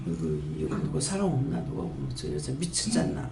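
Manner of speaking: muttering under her breath, glancing round; a low embarrassed aside to herself; self-conscious mutter. Retold or imitated. Woman worried someone sees her laughing, low mutter. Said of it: "이렇게 할까 싶어갖고 주위를 살피게".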